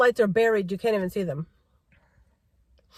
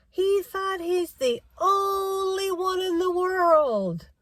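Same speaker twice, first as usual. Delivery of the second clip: mocking; 8 semitones higher, much slower than usual. Mocking the careless pedestrian.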